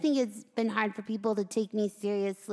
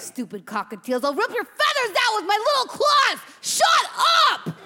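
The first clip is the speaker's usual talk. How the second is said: hammed-up angry outburst; 12 semitones higher, 7 dB louder than usual. Hamster threatening cockatiels, comic rage. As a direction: grumble rising to a high shout.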